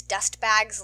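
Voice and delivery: plain reading voice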